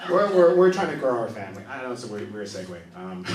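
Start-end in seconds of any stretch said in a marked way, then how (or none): none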